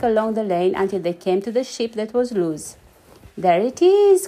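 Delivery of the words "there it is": high and loud, triumphant; proud shout of discovery; excited cry. A narrator's reading. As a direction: cry out pointing. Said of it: "cried Mister Forgetful".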